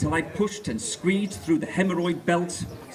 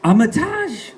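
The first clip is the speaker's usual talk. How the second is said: a touch of a breathy gasp, higher; panting; 8 semitones higher, 6 dB louder, slower than usual. Acted. Gigi gasping to Armitage, lightly panting. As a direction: pant out his name. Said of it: "she panted".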